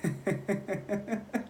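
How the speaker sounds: high, soft creepy giggle; horror-movie laugh. Retold or imitated. Driver turns to me and goes, horror-movie laugh. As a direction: imitate a maniac's quiet staccato snicker. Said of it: "it's like straight out of a horror movie".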